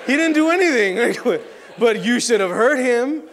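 loud, slow, hammed-up scoff; mock outrage. To the crowd, mocking his own non-trick, loud slow scoff. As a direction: drag it out in loud mock disbelief.

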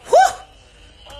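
big high exhale of amazement; loud high whew. Real, not acted. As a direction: blow out a loud whoo. Exhaling after [name] story.

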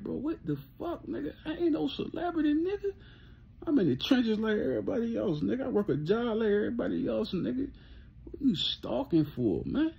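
high, half-laughing exasperated rant; laughing disbelief. Retold or imitated. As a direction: tell off a stalker while cracking up. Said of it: "I'm like".

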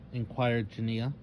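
ordinary delivery, nothing marked

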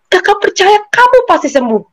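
high, insistent retold promise; acted conviction, pitched well up; emphatic assurance. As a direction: imitate someone fervently assuring. Acting out visitor's confident assurance.

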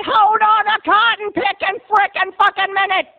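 high hammed-up country rant; outraged protest. Country boy demands his turn, outraged.

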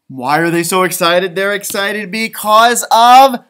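slow build then a high cry of snow; teasing the children before the reveal; building to shout. Acted.

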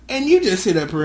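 normal talking voice

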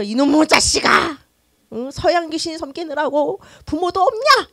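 slow, loud, scolding mother's cry; furious mother. Retold or imitated. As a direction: hurl the curse at the son. Mother curses her son.

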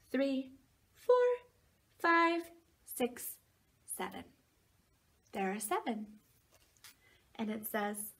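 usual speaking voice

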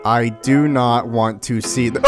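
ordinary talk, nothing marked

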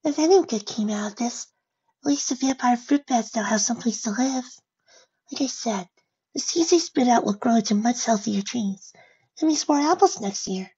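high, small, timid character voice; meek, resigned pony. Acted. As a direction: offer a modest consolation, high and gentle. Fluttershy consoles herself in soft voice.

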